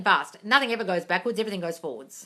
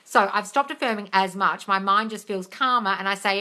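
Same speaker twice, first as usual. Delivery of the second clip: usual speaking voice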